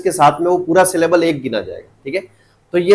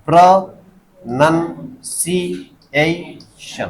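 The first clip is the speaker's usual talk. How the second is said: Pronunciation in five slow, separate beats; syllable by syllable; much slower than usual. Shown as a demonstration. Teacher asking students to count syllables, slow and segmented. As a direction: count out pronunciation as pra, nan, si, ei, shan. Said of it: "कितने हो गए?".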